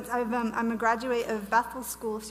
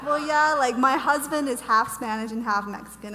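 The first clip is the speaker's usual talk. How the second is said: emphatic explaining; 2 semitones higher, 7 dB louder than usual. Retold or imitated. Answering people surprised by her last name, loudly emphatic. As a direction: set the record straight brightly. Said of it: "And I'm like".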